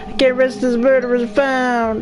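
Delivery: ghostly pleading woman. Acted. Ghost woman begging Elena to find the murderer, high mournful plea. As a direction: plead like a restless spirit who can't move on.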